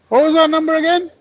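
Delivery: squeaky, hurried panicky player; high, fast and flustered. Pretend. Mock bingo player panic.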